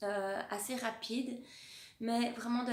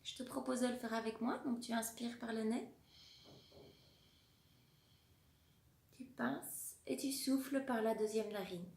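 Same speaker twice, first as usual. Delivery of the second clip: soft, calm guided breathing voice; 3 dB quieter than usual. Acted. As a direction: talk them gently through the breath. Guides breathing exercise along.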